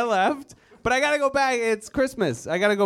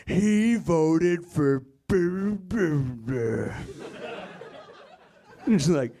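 ominous ad voiceover; slower than usual. Retold or imitated. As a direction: read it like a dark attack ad. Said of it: "ominously voiced ads".

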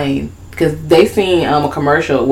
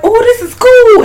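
very high and slow, charmed; high delighted character voice; 5 dB louder than usual. Retold or imitated. Friend retelling the movie, mimicking the doll's delight, high and slow. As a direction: coo it up high like a fascinated kid.

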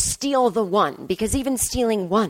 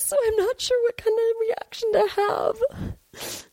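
high fretful complaint; 6 semitones higher than usual. Acted. Character breaks frame, genre complaint.

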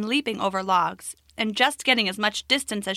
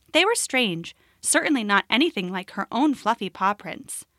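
plain reading voice

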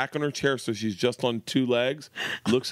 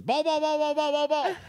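much higher, repeated eager calls; kid calling ball; 13 semitones higher than usual. Retold or imitated. Daughter calling for the pop fly, eager and much higher. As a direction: chant it like an eager kid under a catch.

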